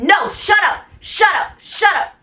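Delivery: high, repeated mock yell; retold screaming. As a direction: imitate the director shutting someone down furiously. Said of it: "stop screaming in my face".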